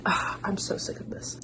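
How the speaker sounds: fed-up, whispered under the breath; annoyed whisper. Real, not acted. Swatting another bug, an irritated whisper. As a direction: mutter it in exasperation.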